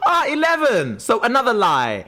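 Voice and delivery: high-pitched theatrical outburst; Klaus, mock outrage. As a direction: pounce on it, pitch leaping up. Klaus's acted outburst at the answer.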